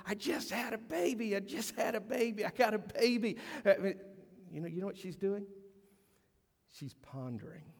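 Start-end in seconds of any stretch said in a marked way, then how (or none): 0.1-3.4 high-pitched, hammed-up new-mother glee; exaggerated bubbly announcement, repeated eagerly; mock excitement. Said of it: "She's jumping up and down".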